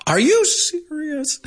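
slow, very high, drawn-out mock disbelief; high-pitched disbelief. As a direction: stretch it out in a squeaky high voice. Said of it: "I'll say it in that high-pitched voice".

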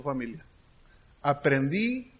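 usual speaking voice

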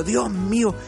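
ordinary talk

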